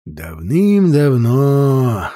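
drawn-out once-upon-a-time voice; storyteller voice. A narrator's reading. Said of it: "голосом сказочника начал говорить Дэвид".

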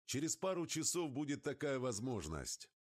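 excited high-pitched call. A narrator's reading. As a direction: call it out high over the engine. Pilot calling out to passenger.

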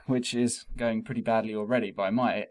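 ordinary talk, nothing marked

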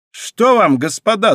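a hint of a Gascon twang in the question; Gascon accent. A narrator's reading. D'Artagnan questions the riders, light Gascon accent. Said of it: "спросил он с гасконским выговором".

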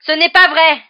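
high, very loud furious yell; screaming. A narrator's reading. Harry refuses Lupin's explanation, screaming. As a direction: yell the denial at full force. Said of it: "hurla Harry".

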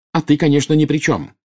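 high, accusing cry; angry outburst. A narrator's reading. Bellatrix accusing Snape of dodging blame, angry. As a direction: fling the accusation at them. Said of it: "гневно выкрикнула Белатриса".

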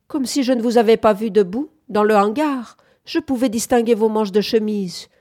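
high, loud, indignant comeback; sharp retort. A narrator's reading. Bunford disputing Henry's excuse about the barn, sharp retort. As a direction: throw the excuse straight back. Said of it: "riposta Bunford".

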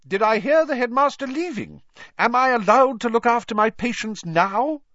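a stern, irritated matron demanding her patients back; cross matron. A narrator's reading. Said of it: "She was in a very bad mood".

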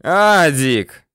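high-pitched, insistent yell. A narrator's reading. Bandit chief offers his man money to shoot, yelling. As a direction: yell out a desperate bribe. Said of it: "орёт Ахмед".

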